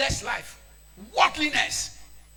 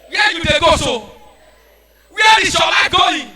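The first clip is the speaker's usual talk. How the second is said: loud, higher, slowing shout; preaching shout; 5 semitones higher, 13 dB louder than usual. Real, not acted. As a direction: cry out the warning. Preacher rebuking youth.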